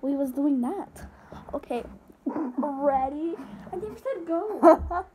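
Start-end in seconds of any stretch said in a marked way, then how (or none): 3.7-5.1 scolding. Real, not acted. Scolding a false start.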